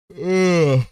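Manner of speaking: loud yawn, then words. Real, not acted. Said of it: "I'm already yawning".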